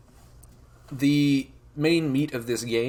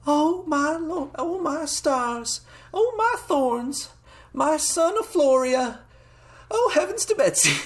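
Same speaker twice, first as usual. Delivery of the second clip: high falsetto farm mom, slow and astonished; drawn-out, high-pitched country exclamations; adorable farm lady; 4 dB louder than usual. Acted. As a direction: gush like a folksy country mother. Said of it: "adorable farm lady expression of just".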